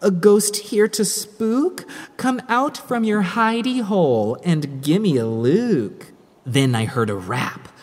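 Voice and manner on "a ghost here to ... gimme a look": raised, eager call; eager taunting. Acted. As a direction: dare the ghost to show itself. Calling out to the ghost.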